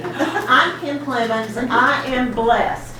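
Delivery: usual speaking voice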